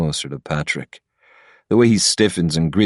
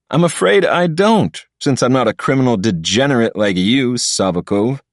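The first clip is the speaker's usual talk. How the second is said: haughty sneer, pitched higher; 6 semitones higher, 3 dB louder than usual. A narrator's reading. Patrick sneering at the criminal, lightly voiced contempt. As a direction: look down your nose at him. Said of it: "Patrick sneers at him".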